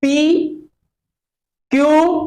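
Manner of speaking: two sounds said apart, with a pause between; P and Q spoken separately with a clear gap; spaced demo. Shown as a demonstration. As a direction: say each letter on its own, leave a gap. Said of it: "जैसे अभी हम बोलते हैं".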